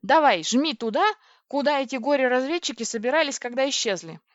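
delighted eagerness. A narrator's reading. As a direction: urge them on eagerly. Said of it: "обрадовалась я".

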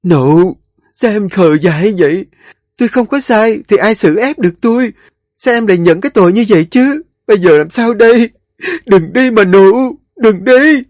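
high, loud crying plea; cling on and wail; young wife wailing as the maid is dragged away. A narrator's reading. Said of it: "khóc thét níu tay con Nụ".

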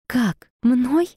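high alarmed gasp of a question; shocked, voice jumping up; shocked disbelief. Acted. Heroine shocked.

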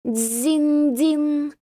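high ringing bell sound; bell chime imitation. A narrator's reading. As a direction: ring it out like a temple bell. Temple bell ringing in scene.

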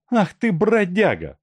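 высоким голосом, сердито бранит бродягу; выругай его, задрав голос; сердитая баба. A narrator's reading. Машка ругает беглеца. (высокий сердитый окрик)

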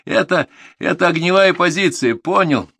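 high, loud, insistent explanation; exasperated instructor's shout; angry yelling. A narrator's reading. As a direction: hammer it into a stubborn pupil. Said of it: "заорал военрук".

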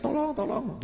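retold shriek at talking volume; mock cry for help. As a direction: act out a mother crying for help, lightly. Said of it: "ibu-ibu teriak-teriak".

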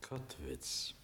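breathless whisper, barely there; whispered name. Acted. Prince softly greets his old colonel in a whisper.

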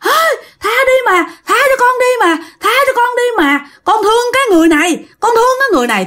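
high-pitched screaming and begging; wailing plea. Acted. Ghost pleads to stay with her loved one, high wail. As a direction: cry out desperately for mercy. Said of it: "nó la".

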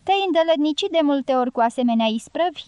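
usual speaking voice

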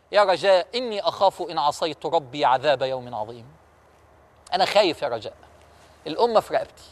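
low, quiet and trembling with awe; a ruler who is afraid of God's judgement; fearful caliph. Acted. Umar voicing fear to Raja. (خوف هادئ وصوت منخفض)

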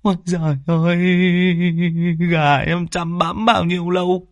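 high, wailing village woman's lament over the dead hens; keening over dead chickens, voice pitched high. Acted. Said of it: "bà Tươi mếu máo".

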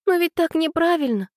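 put-on high, insistent girl's voice; stubborn young protest. A narrator's reading. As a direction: dig your heels in, high-pitched. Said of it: "упрямилась Аня".

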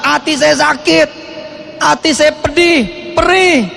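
pained lament. Retold or imitated. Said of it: "Ya Ustadz".

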